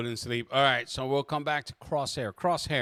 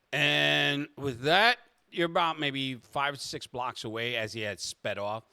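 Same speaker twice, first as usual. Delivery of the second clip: usual speaking voice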